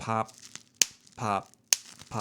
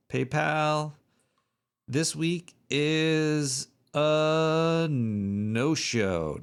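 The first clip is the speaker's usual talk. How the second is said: slow drawn-out; 5 semitones higher, much slower than usual. Pretend. Drawn-out announcement.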